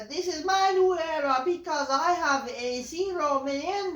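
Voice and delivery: high, loud parody of the catchphrase; mock promo voice. Reviewer mocks the new era promo, high and loud. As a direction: sneer it out high like a cartoon.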